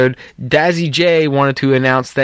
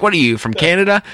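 quick, higher-pitched retort; mock offended; 5 semitones higher, faster than usual. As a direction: imitate a joking, indignant comeback. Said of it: "he got all offended".

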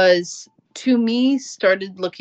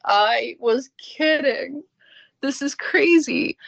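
incredulous; 6 semitones higher, slower than usual. Retold or imitated. Retorting in disbelief to boss.

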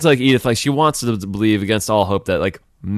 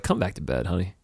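coaxing; 3 semitones lower, 8 dB quieter than usual. Retold or imitated. Wife coaxing husband to bed.

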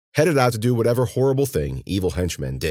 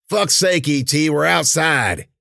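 loud, high exasperated henchman; 10 semitones higher, 5 dB louder, slower than usual. A narrator's reading. Henchman dismissing his partner's jumpiness outside, exasperated. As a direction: complain loudly at a slow-witted partner.

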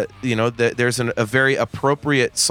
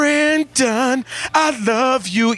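mock sappy [name]; 10 semitones higher, much slower than usual. Mocking [name]'s ballad lyric.

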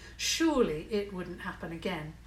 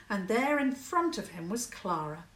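plain reading voice

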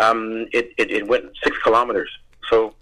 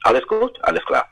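put-on a light German accent, pitch raised; playful German tourist voice; mock German accent; 5 semitones higher than usual. Imitating German fans praising him, in a mock German accent. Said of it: "a lot of folks from Germany go".

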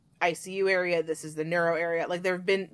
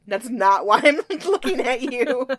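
laughing; 5 semitones higher, 7 dB louder than usual. Retold or imitated. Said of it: "I was like".

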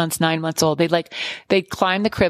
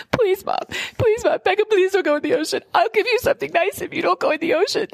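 put-on high, begging child's plea; pleading little girl; 13 semitones higher than usual. Twin Megan begging mom to keep sister from ocean, high childish pleading. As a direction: beg like a scared little girl bargaining. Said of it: "She's like".

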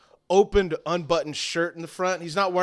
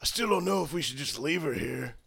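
raspy thug voice; 6 dB quieter than usual. Acted. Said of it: "he stands up, he goes".

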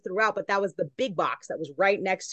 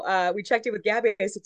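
ordinary delivery

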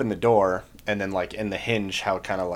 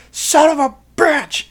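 high, drawn-out furious yell; outraged scream; 9 dB louder, slower than usual. Retold or imitated. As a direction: explode like an NCO hearing the prank. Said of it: "is just him screaming".

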